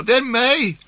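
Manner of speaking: high, loud panicked cry; frantic shout. Acted. Wife begs husband aiming the rifle, frantic shout. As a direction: shriek to stop the shot. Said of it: "ropte kona".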